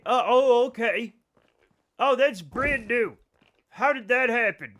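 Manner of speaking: startled surprise. Real, not acted. Streamer reacting to chat's new weirdness, loud startled surprise. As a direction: caught off guard, voice jumps up. Odd chat messages appear.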